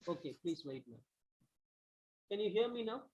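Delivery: faint voice, almost lost; hardly heard, far too soft; barely audible. Real, not acted. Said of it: "Your voice is very low".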